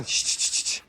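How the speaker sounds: soft unvoiced shushing. Real, not acted. Owner shushing the birds, soft hiss. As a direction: hiss the animals quiet.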